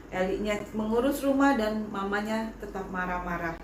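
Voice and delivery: usual speaking voice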